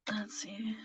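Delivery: whispered, barely there self-talk; hushed under the breath; whispered aside. Real, not acted. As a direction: whisper it to yourself while thinking. To herself while reading cards.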